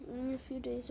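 plain reading voice

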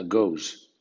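goes said the English way; English word goes. Shown as a demonstration. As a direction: model how goes sounds. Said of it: "que suena". (goes en inglés)

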